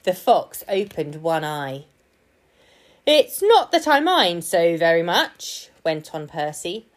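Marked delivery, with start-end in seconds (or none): 3.1-5.7 loud growly lion complaint. A narrator's reading. Percy pretending to be the lion bench, growly. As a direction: grumble loudly as the lion. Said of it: "in a deep, growly voice".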